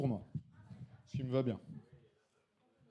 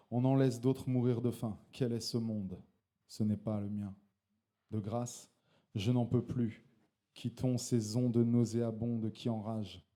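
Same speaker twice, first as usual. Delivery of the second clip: intense slam delivery; slam poetry; 4 semitones lower, slower than usual. Acted. Slam poet declaiming his poem to the audience. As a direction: perform the poem with conviction.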